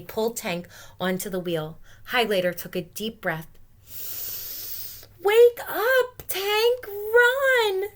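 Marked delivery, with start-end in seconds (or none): 5.2-7.9 high loud pleading cry; urgent shout. Acted. Highlighter shouting to wake Tank. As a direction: yell at the sleeping hamster to wake. Urging hamster awake.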